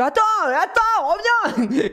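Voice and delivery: high, slow, pleading call; calling after cat. Retold or imitated. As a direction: call the cat back, voice soaring up.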